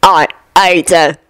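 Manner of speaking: slow, gloating werewolf; Greyback. A narrator's reading. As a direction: relish it slowly through a grin. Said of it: "bared his teeth in a horrible grin".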